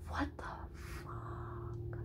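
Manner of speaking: breathy whispered disbelief; stunned whisper. Retold or imitated. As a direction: whisper it in shock. Stunned on seeing Elijah.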